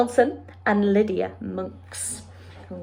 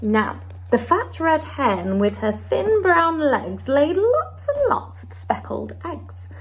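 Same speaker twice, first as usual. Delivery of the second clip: lively storybook read-aloud with character voices; story time voice for a children's picture book; 3 semitones higher than usual. Acted. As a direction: read it to a class of little ones, bright and expressive.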